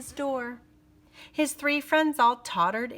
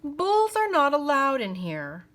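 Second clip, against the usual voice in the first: sneering clerk; 5 semitones higher, 5 dB louder than usual. A narrator's reading. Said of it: "the clerk said with a snarky sneer".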